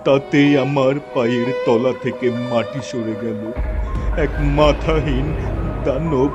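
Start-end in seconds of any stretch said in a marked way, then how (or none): none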